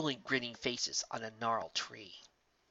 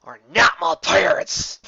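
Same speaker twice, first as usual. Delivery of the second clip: angry outburst; 5 semitones higher, 12 dB louder, slower than usual. Acted. Character yells at fake parents.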